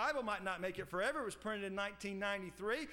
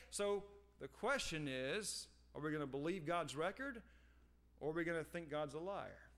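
usual speaking voice

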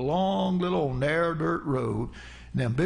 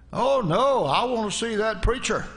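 higher and louder, pushy and tipsy; insistent drunk shout; 7 semitones higher, 3 dB louder than usual. Retold or imitated. Drunk husband insisting to John, loud and pushy. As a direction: demand it like a man half drunk.

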